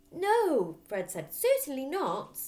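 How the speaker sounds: firm refusal. Acted. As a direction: shut the request down. Fred refusing firmly.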